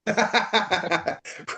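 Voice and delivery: high burst of real laughter; laughing. Real, not acted. Laughs at joke.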